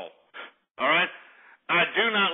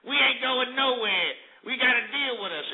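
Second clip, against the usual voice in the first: higher, put-on voice of a smug freeloader; mocking loser voice; 7 semitones higher than usual. Ranter mocks losers who say they're not going anywhere, taunting higher voice. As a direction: sneer it like a loser who won't leave. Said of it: "They're just gonna sit there and be like".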